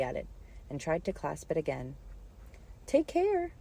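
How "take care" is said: high, loud warning; cried out. A narrator's reading. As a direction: call out in sudden alarm. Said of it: "cried Alice".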